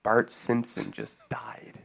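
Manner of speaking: whispered fake news, slow. Pranking his friend, whispering the fake news. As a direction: breathe it out like a secret. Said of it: "I would just be like".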